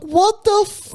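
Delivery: high, incredulous yelp on every word; retold disbelief. Retelling finding the subscription charge, incredulous high yelp. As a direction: squeak it out like you can't believe the charge.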